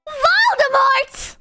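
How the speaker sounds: high, drawn-out mock cry; dramatic reveal. Heroine reveals the villain, high dramatic cry. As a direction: stretch the villain's name out high.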